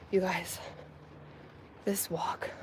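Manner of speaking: breathy whisper of disbelief. Real, not acted. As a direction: whisper it, exhausted. Whispered aside about the walk.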